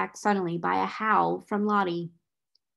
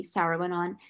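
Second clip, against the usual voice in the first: usual speaking voice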